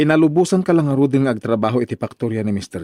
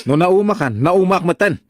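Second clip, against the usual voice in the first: raised, sharp comeback; angry retort; 6 semitones higher than usual. Acted. Husband Victor snapping back at his complaining wife, angry. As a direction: throw her words back at her.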